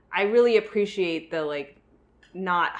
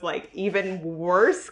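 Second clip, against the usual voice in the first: usual speaking voice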